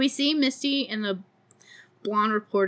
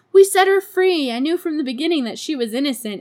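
loud, higher, quick gloating; mock gloating; 6 semitones higher, 6 dB louder, faster than usual. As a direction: crow it down the phone, fast. Patty gloating on payphone.

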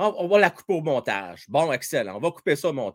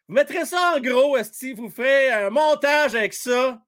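shouting; 10 semitones higher, 3 dB louder than usual. Real, not acted. Laughing at his own blooper.

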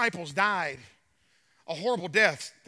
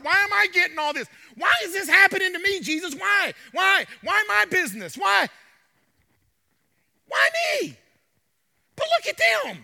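higher, louder whining that builds to shouts; complaining Peter; 7 semitones higher, 6 dB louder than usual. Acted. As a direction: pour out every grievance, building. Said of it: "he says".

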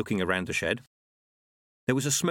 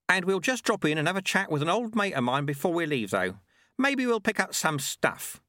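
put-on easygoing old sweeper, higher than narration; casual sweeper voice, lifted in pitch; Lutze; 8 semitones higher than usual. Sweeper proposing a chat with an old mate, casual.

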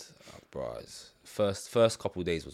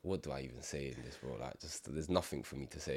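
quiet helpless; 5 semitones lower, 12 dB quieter than usual. Real, not acted. Soft helpless retelling.

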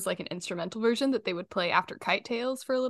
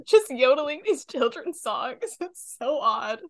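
laughing while talking; 7 semitones higher, 3 dB louder than usual. Real, not acted. Laughing about the odd yodel part.

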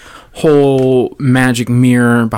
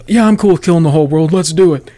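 brisk, a little higher, gung-ho go-ahead; mock eager president; 5 semitones higher, 5 dB louder than usual. President casually ordering a nuke, mock eager. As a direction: toss off the launch order like it's nothing. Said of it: "Biden's like".

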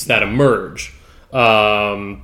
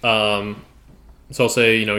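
usual speaking voice

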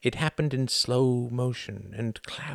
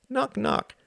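put-on very high little girl voice; little girl knock. A narrator's reading. Lillian tugging his ear, very high child voice. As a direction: chirp it like a tiny child.

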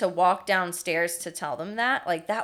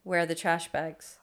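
unraised-voice demo; 3 semitones lower, 5 dB quieter than usual. Shown as a demonstration. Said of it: "And I said".

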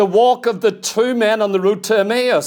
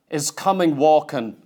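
ordinary talk, nothing marked

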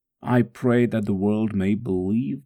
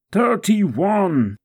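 loud, high-pitched cry; pained shout under the whip; agonised count; 8 semitones higher, 5 dB louder than usual. Acted. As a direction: cry out the count as the lash hits. Counting lashes during the scourging.

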